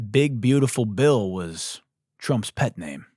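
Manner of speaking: ordinary delivery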